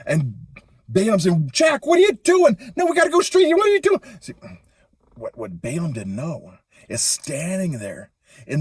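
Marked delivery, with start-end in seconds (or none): 1.5-4.0 much higher and louder, panicked scolding; frantic yell. Acted. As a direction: yell at the donkey veering off the road. Balaam yelling at his donkey.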